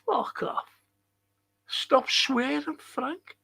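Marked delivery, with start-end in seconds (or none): 1.7-3.4 high, soft mimicked telling-off; mocking scold. Streamer echoing whoever tells him off, mock scolding.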